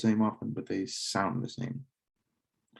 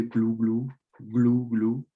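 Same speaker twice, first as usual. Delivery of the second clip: turkey gobble demo; 4 dB louder, slower than usual. Shown as a demonstration. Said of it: "une dinde".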